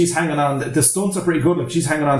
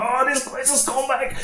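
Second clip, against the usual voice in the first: high-pitched, put-on climber's wail; mock panicked cry; 11 semitones higher, 5 dB quieter than usual. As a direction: imitate a climber losing his nerve. Acts panicking climber's reply.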